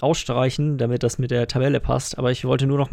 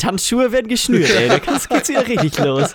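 mock hype; 8 semitones higher, 5 dB louder than usual. Joking hype about dancing.

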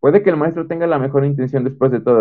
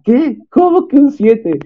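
high, loud mock outrage; incredulous mom shriek, acted out; shocked mother; 12 semitones higher, 4 dB louder, slower than usual. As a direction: imitate an aghast mom, drawn out and pitched way up.

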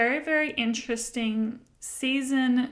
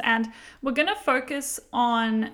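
ordinary delivery

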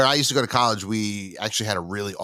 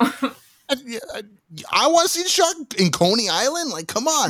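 much higher, whiny excited plea; pleading eagerly. Retold or imitated. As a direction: beg like a kid who must see the shark. Pleading with dad about shark.

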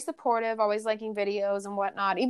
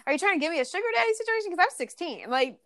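mock outraged retort; 6 semitones higher than usual. Retorting to a creepy commenter.

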